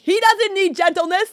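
high, loud, outraged comeback; acted-out furious protest; indignant retort. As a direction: fire back shrieky and defiant. Acted angry retort to her dad.

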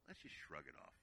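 soft, low voice of Jesus, giggling kindly; gentle Jesus. Retold or imitated. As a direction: ease the burden off him gently.

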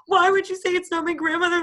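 imitating a triggered friend, very high; mock upset wail. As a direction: wail the accusation in a high pitch. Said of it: "that triggered her".

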